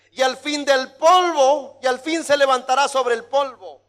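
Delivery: high, loud, building proclamation; impassioned preaching. Acted. Preacher acting out dying Job's proclamation, impassioned preaching. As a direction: build from urgent wish to ringing declaration.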